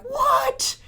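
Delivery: very high disbelieving squeak; shocked. Retold or imitated. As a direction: react in total disbelief. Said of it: "And you're like".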